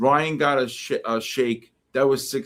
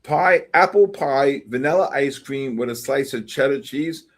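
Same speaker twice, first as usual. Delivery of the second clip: mock outrage; 3 semitones higher, 5 dB louder than usual. Real, not acted. Reading out the cheddar pie, loud outrage. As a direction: scold the menu for ruining dessert.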